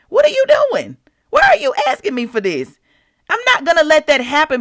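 high, loud incredulous rebuke; mock scolding. The Lord questioning her begging, high mock scolding. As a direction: demand it high, as if astonished.

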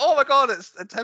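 high, loud burst of recognition; retold amazed recognition. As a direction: act out spotting someone you know on screen. Retells spotting a familiar actor.

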